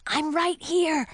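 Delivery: high, slow, hushed-urgent reply; answering call. Acted. Invisible partner answering her call, high slow reply. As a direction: answer so they can find you.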